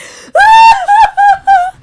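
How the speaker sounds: loud high-pitched mock sobbing; mock wailing. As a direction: wail out in exaggerated, broken bursts. Acting out godsister's crying run.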